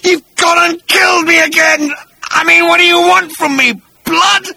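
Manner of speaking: very high, loud, agonised outrage; pained gurgle. Acted. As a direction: cry out as a dying victim. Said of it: "he gurgled painfully".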